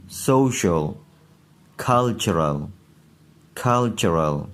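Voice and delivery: each word said twice clearly; teacher reading a listen-and-repeat list; model pronunciation. Shown as a demonstration. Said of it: "सुन लीजिए". (हर शब्द दो बार साफ़ बोलना)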